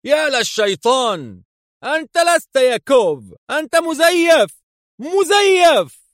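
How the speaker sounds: high, loud, building alarmed yell; furious shock at an impostor; choked shout. A narrator's reading. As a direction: shout the discovery, stretching the last word. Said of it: "وهتف في صوت مختنق".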